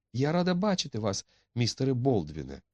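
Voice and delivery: high-pitched, anxious politeness to a feared guest; frightened young woman. A narrator's reading. Etti greets the unwelcome visitor Baldwin, high and nervous.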